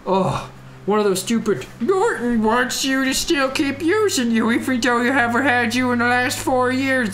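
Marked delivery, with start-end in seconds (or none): 1.8-7.1 high sarcastic mimicry of an ad; mock the nagging software; mocking popup voice. Mocks Norton popup.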